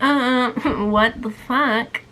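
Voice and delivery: slow, drawn-out what the fuck through a laugh; stunned laugh on finding the files gone; laughing disbelief. Retold or imitated. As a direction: let the shock dissolve into a laugh. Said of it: "And I was like".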